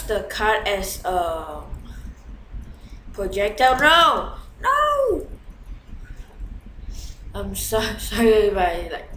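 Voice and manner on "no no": loud, high screamed NO; real scream. Real, not acted. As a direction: scream in sudden panic. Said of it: "scream or something".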